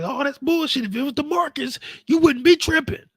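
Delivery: high, loud whining complaint; mock whiny friend. Friend Jazz complaining about DeMarcus in a loud, high whine. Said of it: "He's like".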